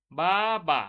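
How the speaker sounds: বা said as one clipped beat; syllable demo. Shown as a demonstration. Teacher sounds out a syllable for students, clearly. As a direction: sound out বা, one push of breath. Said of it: "এটা এক ঝোঁকে উচ্চারণ করতে পারছি".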